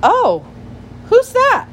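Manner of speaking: high, startled squeak of a question; startled small creature, pitched very high; little frightened voice. A narrator's reading. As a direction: call out loudly in a tiny scared voice. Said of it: "a little frightened voice called out".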